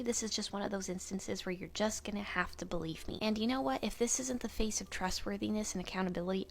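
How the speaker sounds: usual speaking voice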